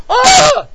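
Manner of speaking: very high, loud shriek; imitated sudden scream. Man demonstrating the cry he suddenly heard in the store, high scream. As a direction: let out a sudden piercing "Ah!". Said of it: "Like this".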